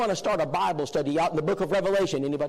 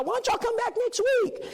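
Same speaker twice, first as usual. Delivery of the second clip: high sweet invite. Retold or imitated. Host sweetly inviting guests back.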